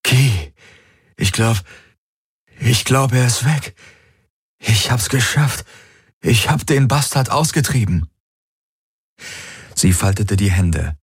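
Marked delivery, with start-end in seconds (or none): 0.0-8.1 higher, breathless relief, voiced as Garland; he can't believe it's over; relieved man. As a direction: Garland tells Kirai the voice is gone.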